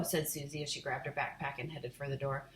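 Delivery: ordinary delivery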